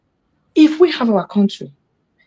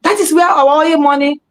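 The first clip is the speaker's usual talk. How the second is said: raised, higher, louder voice of indignant locals; mock local complaint; 8 semitones higher, 6 dB louder than usual. Locals grumbling about the oil money, raised and loud. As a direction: throw it out like an aggrieved bystander.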